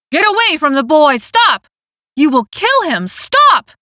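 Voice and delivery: loud, rising frantic cries of stop; panicked shouting. A narrator's reading. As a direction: yell in panic to stop them.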